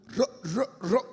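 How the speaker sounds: halting, repeated broken syllable; stuttering. Acted. Acting Moses trying to speak to the rock, stuttering. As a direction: stammer on the first sound of the word. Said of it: "yo gagueando".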